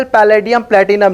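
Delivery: normal talking voice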